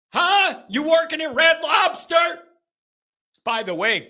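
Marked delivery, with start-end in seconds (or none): 0.1-2.1 high-pitched incredulous prodding; mock interrogation. Host needling Pat about Red Lobster, high incredulity. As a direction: push for an answer, voice way up.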